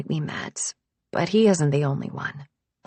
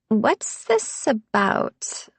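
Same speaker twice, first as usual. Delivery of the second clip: slow, wary, in Harper's higher voice; careful question; 4 semitones higher, much slower than usual. A narrator's reading. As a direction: ask slowly, guarded. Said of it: "Harper asks carefully".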